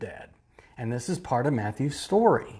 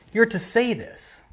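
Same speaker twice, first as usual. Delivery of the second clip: much higher, commanding; priests' order; 8 semitones higher than usual. Retold or imitated. Pastor voices the chief priests ordering the soldiers. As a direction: bark it as an order. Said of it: "we're gonna say".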